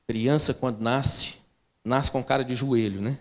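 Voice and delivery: voz baixa e lenta, quase confidencial; falando baixo. Real, not acted. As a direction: baixe a voz como quem conta um segredo. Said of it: "Deixa eu falar baixo". (em tom baixo, contando à congregação)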